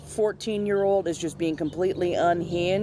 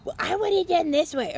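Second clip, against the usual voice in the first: high mock whine of an entitled customer; insistent customer; 12 semitones higher than usual. Barista repeating the customer's demand in a high mock voice. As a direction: pitch it way up, nasal-free but petulant.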